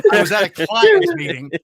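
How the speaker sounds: careful over-enunciated client's meeting. Shown as a demonstration. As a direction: say client's clearly, every sound. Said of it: "I'll enunciate this time".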